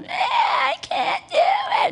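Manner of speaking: put-on high, drawn-out toddler wail; screaming tantrum. Little girl who can't make a kite, imitated screaming. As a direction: imitate a two-year-old's screaming meltdown. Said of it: "and she's screaming".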